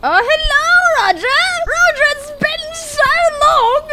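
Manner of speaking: put-on high, excited hello to an old friend; overjoyed greeting. Bear greeting the rabbit Roger with high excitement. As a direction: squeal it out like a long-lost reunion.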